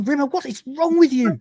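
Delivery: high-pitched exasperated outburst; acted exasperation. Lister exasperated at Rimmer.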